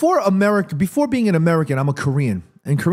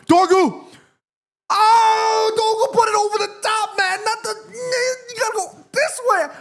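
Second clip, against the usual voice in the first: excited yelling. Real, not acted. Streamer watching United, yelling at the screen in excitement. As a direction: cry out in real excitement as the play unfolds.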